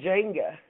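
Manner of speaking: Jenga said with the first syllable as in Jennifer; own pronunciation demo. Shown as a demonstration. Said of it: "how do you say that word".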